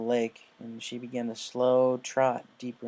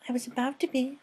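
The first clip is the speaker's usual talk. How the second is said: Fluttershy voice; 13 semitones higher than usual. Acted. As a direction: speak like a shy gentle mare.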